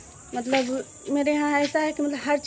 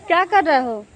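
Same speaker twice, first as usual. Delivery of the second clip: loud, higher-pitched call; raised voice, pitched up to carry; 4 semitones higher, 9 dB louder than usual. Real, not acted. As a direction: call out a question to someone across the way. Calls out to people nearby.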